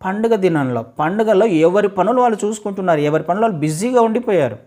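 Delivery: ordinary delivery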